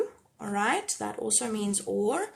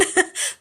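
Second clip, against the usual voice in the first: delighted laugh; 4 dB louder than usual. Real, not acted. Laughs at like count.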